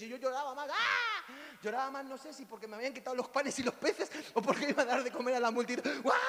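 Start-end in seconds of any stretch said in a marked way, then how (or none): 0.7-1.2 very loud, high hammed-up wail; mock sobbing wail. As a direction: throw out a huge fake cry. Retold weeping more.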